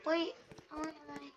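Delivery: ordinary talk, nothing marked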